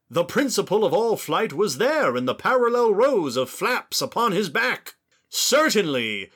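put-on high, grand old-timey British accent. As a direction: read it like an old-timey Brit. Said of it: "like, a old-timey British accent".

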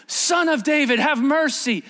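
high, pressing plea; yelling. Acted. Blind beggar yelling to Jesus in Matthew's account, pressing plea. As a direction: yell it high in a quick burst. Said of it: "There were two yelling".

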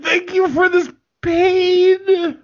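high, slow, pained mock gratitude; mock thanks. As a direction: thank them sweetly while it hurts. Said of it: "I'm like".

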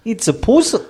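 high-pitched attempt at Australian; squeaky mock Australian accent. Shown as a demonstration. Second go at homeowner's line, high mock Australian accent. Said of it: "Can you say that in Australian accent?".

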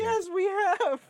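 very high, loud, drawn-out; exasperated agreement. Real, not acted. Exasperated agreement about the tangent.

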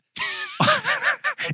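squealing high burst of laughter. Real, not acted. Laughing at the host's hammered voiceover story, high squealing laugh.

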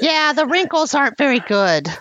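high-pitched cheeky goblin; a sly little goblin piping up high. Acted. GM voicing goblin NPC.

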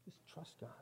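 unvoiced whisper of the cliché. Shown as a demonstration. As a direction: breathe the words out as a pious aside. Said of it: "we just need to trust God".

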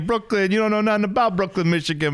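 put-on high, swaggering New York tough-guy accent; mock New York accent telling off a guy from Brooklyn, Michigan; terrible New York accent. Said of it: "We're just doing terrible New York accents now".

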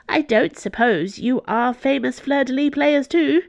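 put-on high-pitched little old nun, pleased to have visitors; tiny elderly nun, welcoming. A narrator's reading. Elderly nun recruiting visitors for a team, pleased.

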